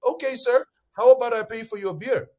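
playful mock courtesy to a doubter; mock polite. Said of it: "I'll be like".

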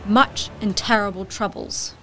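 ordinary talk

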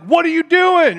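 high, loud incredulous question; mock appalled outburst; acted disbelief. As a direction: cry out in comic astonishment. God reacts to homemade outfit.

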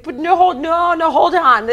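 high, loud flustered "hold on"; loud protest. Retold or imitated. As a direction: backpedal loudly and high. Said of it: "And it's like".

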